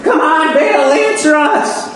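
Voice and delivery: high pleading call, slowing on answer us; frantic Baal prophets. Acted. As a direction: beg the idol, half mocking their frenzy. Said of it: "They're jumping up and down, and they're like".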